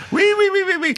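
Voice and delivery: very high, squealing wee wee wee; piggy squeal. Retold or imitated. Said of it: "this one went".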